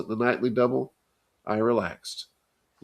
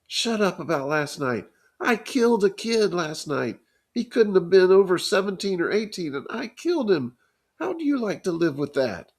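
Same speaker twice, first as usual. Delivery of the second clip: high, anguished, near tears; crying boy; 8 semitones higher than usual. A narrator's reading. Johnny confessing to Ponyboy, high anguished near tears. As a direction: spill out the guilt in a rush of tears. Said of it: "He was crying".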